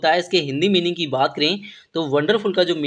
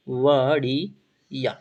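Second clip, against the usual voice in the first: बढ़िया drawn out syllable by syllable; slowly broken up; 4 semitones lower, much slower than usual. Shown as a demonstration. Teacher repeating the Hindi meaning for students, slowly broken up. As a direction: stretch and split the word for learners.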